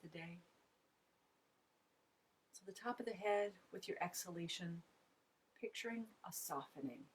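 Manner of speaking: spacious, hushed relaxation cues; guided meditation. Real, not acted. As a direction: guide listeners into relaxation, leaving long silences between short phrases. Leads a body scan meditation.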